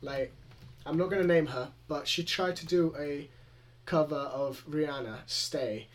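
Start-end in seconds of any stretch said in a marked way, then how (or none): none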